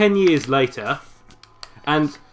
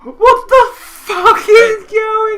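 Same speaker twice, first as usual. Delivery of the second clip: much higher, slow, laughing; shocked laughing; 6 dB louder, much slower than usual. Real, not acted. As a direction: laugh out in disbelief. Listener reacting to twist.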